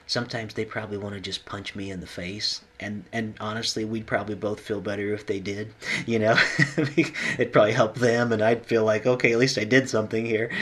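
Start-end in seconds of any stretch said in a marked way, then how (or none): 5.9-10.5 loud, laughing aside; amused, laughing. Real, not acted. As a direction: talk through a laugh, voice raised. Laughs at own joke while talking.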